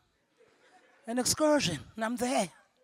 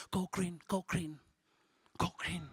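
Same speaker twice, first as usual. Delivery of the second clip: softer go green fading to a whisper; echoing advice; 4 semitones lower, 11 dB quieter than usual. Retold or imitated. Recalled diet advice echoing, fading into a whisper. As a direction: let the phrase fade away like a haunting echo. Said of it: "I used to hear that a lot".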